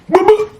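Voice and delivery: very high, loud double boop; imitated punch noises. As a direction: pop out two squeaky hit sounds. Imitates the hits again.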